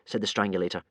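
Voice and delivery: ordinary delivery, nothing marked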